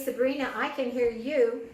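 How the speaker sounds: normal talking voice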